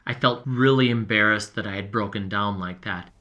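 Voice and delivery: ordinary delivery, nothing marked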